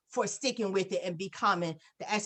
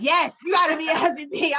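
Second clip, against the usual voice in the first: loud, laughing yes with a higher pitch; laughing insistence; 7 semitones higher, 6 dB louder than usual. Retold or imitated. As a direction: shout it back through a laugh. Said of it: "And he's like".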